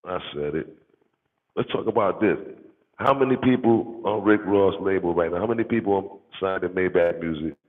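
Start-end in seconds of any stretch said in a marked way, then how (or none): 1.6-7.6 louder, higher, calling him out; fired-up challenge. Real, not acted. Calling out a rival label, loud and fired up. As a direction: raise the voice and challenge.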